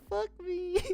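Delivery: high laughing groan; laughing curse. Real, not acted. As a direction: curse through the laugh, exasperated. Laughing at own mistake.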